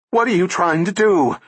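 high, loud screaming question; harsh scream. A narrator's reading. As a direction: scream it at him. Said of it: "Her voice rose to a harsh scream".

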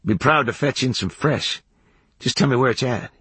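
slightly folksy character voice; drifter kid offering. A narrator's reading. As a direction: offer help in a rustic voice. Kid offers to fetch water.

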